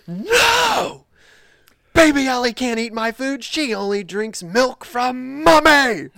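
put-on high, loud outraged little kid; imitated child's yell. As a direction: yell it like an indignant child, stretching the end. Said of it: "and then he yells".

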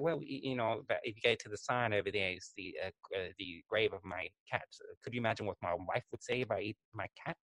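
a hint of a rambling old Englishman; old British taxidermist. Imitating the taxidermist on the documentary, soft and rambling. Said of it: "he's like".

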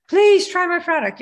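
high, loud, pleading beggar; hammed-up begging plea. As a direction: plead like a desperate newbie seller. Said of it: "feel like you're begging".

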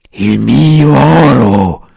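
slow, loud dragon voice, full of malice; the dragon gloating cruelly; perfidious dragon. A narrator's reading. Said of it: "ripeté Efesto con perfidia". (drago perfido, lento e forte)

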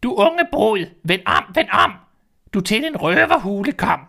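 high, loud sing-song bird cry; shrill warning call of a caged bird. Acted. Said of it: "råbte den til hende".

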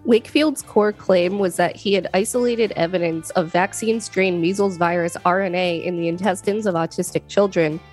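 ordinary delivery

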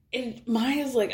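usual speaking voice

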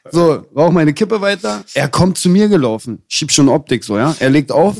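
ordinary delivery

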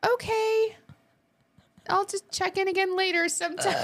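high, small puppet voice backing off; deflated Elmo. Retold or imitated. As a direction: shrink away like a hurt puppet. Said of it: "Elmo was like".

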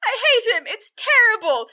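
put-on high, loud teenage wail; sulky wailing daughter. Daughter wailing to the family about her boyfriend, high and loud. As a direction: wail like a heartbroken girl. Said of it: "And she's like".

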